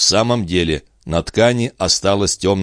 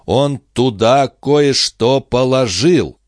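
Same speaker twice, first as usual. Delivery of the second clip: slow, deliberate reveal; 6 semitones higher, 4 dB louder, slower than usual. Acted. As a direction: draw each word out slowly. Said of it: "медленно проговорил он".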